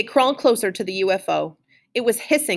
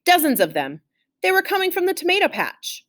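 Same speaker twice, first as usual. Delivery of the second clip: ordinary delivery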